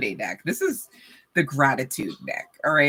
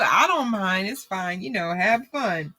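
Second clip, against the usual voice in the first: ordinary talk, nothing marked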